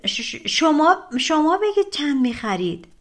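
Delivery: lightly voiced stammering panic; flustered stammer. A narrator's reading. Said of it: "پسر حسابی دست و پاش رو گم کرد".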